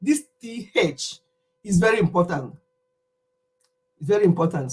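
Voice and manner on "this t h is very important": ordinary talk, nothing marked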